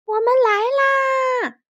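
high, loud, long-drawn holler; sustained rescue shout; hedgehog calling out. A narrator's reading. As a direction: call across the water to those waiting. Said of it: "他们听到了小刺猬的呼喊".

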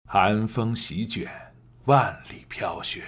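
slow, measured narration of the snowy opening; story narration. Shown as a demonstration. Teacher demonstrating audiobook narration: slow and measured. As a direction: set the cold scene slowly for the listener. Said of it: "我可以给你做一个演示".

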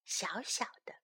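very soft, low whisper; tiny whisper. Shown as a demonstration. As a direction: shrink the words to a whisper.

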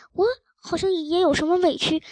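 usual speaking voice

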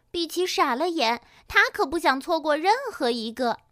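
plain reading voice

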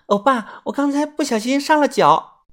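high cutesy coaxing; high sweet wheedling girl voice; coquettish girl. A narrator's reading. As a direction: play for sympathy. Girl coos to narrator.

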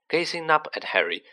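usual speaking voice

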